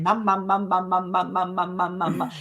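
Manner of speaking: put-on toddler's word chanted nonstop, evenly; constant repeating. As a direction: repeat it over and over without a break. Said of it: "just constantly".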